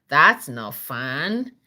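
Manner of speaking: ordinary delivery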